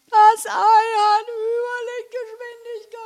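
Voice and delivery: very high, slow, stretched-out cry; high drawn-out wail. A narrator's reading. Wilma is dragged off by the racing egg, high drawn-out wail. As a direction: wail it high as if being carried away.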